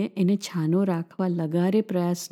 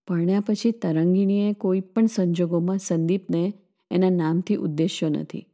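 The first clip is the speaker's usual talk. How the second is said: plain reading voice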